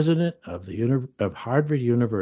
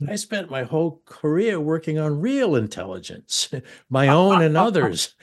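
higher-pitched, mock-indignant retort breaking into laughter; playful indignant comeback; 7 semitones higher, 4 dB louder than usual. Host retells a comeback about real intelligence, mock indignant. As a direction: protest with a laugh, voice lifted.

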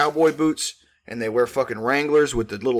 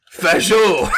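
hype announcer; 5 semitones higher, 7 dB louder than usual. Pretend. Announcer punchline.

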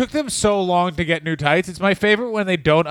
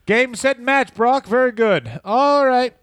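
much higher, mock sunny congratulation; mock cheerful sign-off; 8 semitones higher than usual. Congratulating Brock Lesnar on winning, mock cheerful and much higher. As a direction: cheer the winner in a falsetto-ish tone.

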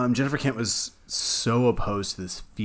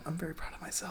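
soft proud whisper; 5 semitones higher, 16 dB quieter than usual. Real, not acted. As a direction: whisper it like a secret boast. Boasting aside, dropping to whisper.

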